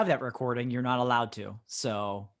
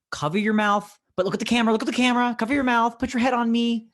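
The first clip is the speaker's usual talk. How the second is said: mom directing; 10 semitones higher, 4 dB louder, faster than usual. Retold or imitated. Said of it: "she's like".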